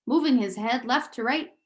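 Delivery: ordinary delivery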